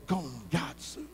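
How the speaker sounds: very soft trailing gongatsu; softly muttered gongatsu; whispered. Shown as a demonstration. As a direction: breathe the word under your breath. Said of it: "Everyone has whispered gongatsu".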